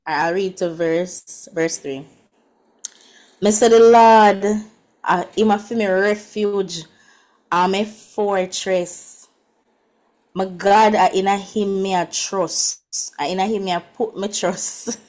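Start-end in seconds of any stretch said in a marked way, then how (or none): 3.4-15.0 emphatic scripture reading in Jamaican patois. Shown as a demonstration. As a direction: proclaim it in Jamaican patois, phrase by phrase. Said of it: "let me try in patois first".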